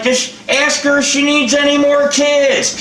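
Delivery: high-pitched mock yell across a park; retold yell. Man retells yelling to daughter-in-law across the park, mock yell. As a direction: call it out high, as if across a distance. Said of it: "And I'm yelling. I'm like".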